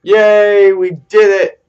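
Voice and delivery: loud, high victory cheer. Real, not acted. Player cheering his win out loud. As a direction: shout it like you just won.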